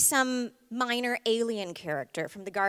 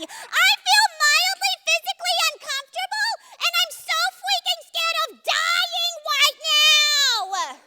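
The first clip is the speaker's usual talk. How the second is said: panicking amygdala; 7 dB louder than usual. Acted. The amygdala shrieking that it is scared of dying. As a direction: wail like the brain's frightened alarm.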